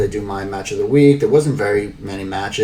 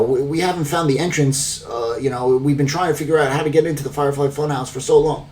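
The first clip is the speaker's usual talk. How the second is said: higher, quick mock Corey voice; Corey impression; 6 semitones higher than usual. Corey on commentary, baffled about the Funhouse, higher mock voice. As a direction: lift the voice and rattle it off like a puzzled commentator. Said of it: "Corey is like".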